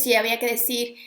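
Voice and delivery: ordinary delivery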